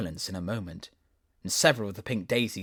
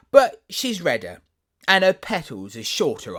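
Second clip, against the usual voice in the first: rose voice; 6 semitones higher, 9 dB louder than usual. Acted.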